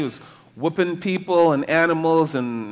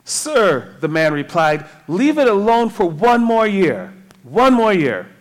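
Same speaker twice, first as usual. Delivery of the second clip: louder, emphatic reading of a plea; scripture reading; 4 semitones higher, 8 dB louder than usual. Acted. Preacher reading the vineyard worker's reply, loud and emphatic. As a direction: read out the plea with raised pitch and force. Reads the parable aloud.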